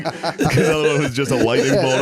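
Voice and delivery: normal talking voice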